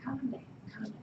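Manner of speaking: high, soft soothing voice; calm yourself down gently like a nervous system; high hushed whoa, calm down; soothing parasympathetic. Pretend. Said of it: "parasympathetic in the background trying to go".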